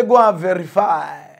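loud, emphatic "Go and verify"; loud quoted catchphrase; quoting Peter Obi. Retold or imitated. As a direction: belt the catchphrase. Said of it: "He say".

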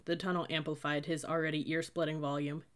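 plain reading voice

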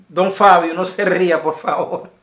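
loud, high teasing call to stop laughing; half-joking yell at Don Fabio not to laugh; hammed-up stern plea; mock scolding. Playfully scolding laughing friend.

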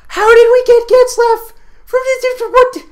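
high, loud squeal of disbelief; incredulous excitement. Real, not acted. Gamer asking how he got the card, squealing in disbelief. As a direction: squeal the question, unable to believe it. Stunned by the pull.

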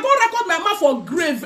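usual speaking voice

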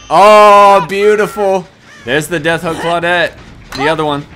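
loud, high cheer of delight; excited delight. Real, not acted. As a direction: crow over a great hit, loudly. Delighted at a successful hit.

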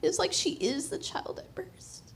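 hushed, breathy, overcome; tender whisper. Real, not acted. Mother testifying her foster daughter feels like her own, whispered. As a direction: let the words almost vanish.

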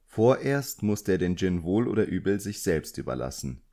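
plain reading voice

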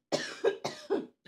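sudden harsh coughing fit. Real, not acted. Vlogger chokes mid-review, sharp coughing fit. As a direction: cough hard, choking on it. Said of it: "I'm choking and this hurts".

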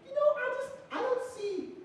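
very high, meek little voice; timid wimp. Acted. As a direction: squeak it out, too cowardly to object. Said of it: "I was kind of a pussy to say that".

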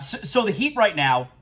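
usual speaking voice

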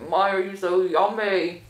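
chewing while talking; talking around a bite; mouth full. Real, not acted. Seen 2: bites burger before start, chews early; later just holds it.

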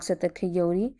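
ordinary delivery

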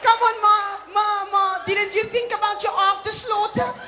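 high, loud protest to mother; exasperated pleading. Acted. Acted son scolding his mother.

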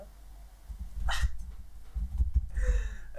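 high laugh. Real, not acted. Laughing at friend.

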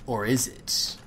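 normal talking voice

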